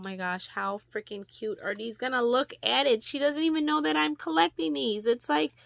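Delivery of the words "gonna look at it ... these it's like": much louder, higher, thrilled; loud, high delight at the gift; excited exclaiming. Real, not acted. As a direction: burst out with real excitement. Excited about the matching earrings.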